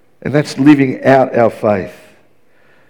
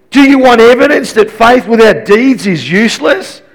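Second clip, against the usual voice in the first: mocking challenge in a raised pitch; James; 6 semitones higher than usual. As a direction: taunt them with a high rhetorical question. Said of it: "now he's almost mocking us".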